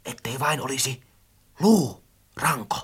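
soft, low, fearful guess; hushed murmur. A narrator's reading. Friend guesses at the clattering thing, hushed. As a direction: murmur it back secretively. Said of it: "Möttönen supatti vastaan".

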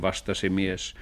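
ordinary delivery, nothing marked

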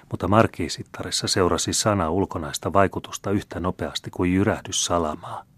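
usual speaking voice

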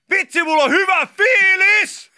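very high, loud, slow party shout; imitated happy yell. Retelling friend partying alone at night, imitated yell. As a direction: imitate a lone reveller yelling out. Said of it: "sit huutaa".

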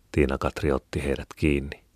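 ordinary delivery

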